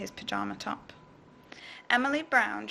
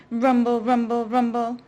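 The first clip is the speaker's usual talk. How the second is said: louder rumble effect; loud rumbling; 8 dB louder than usual. A narrator's reading. Sound effect repeated louder.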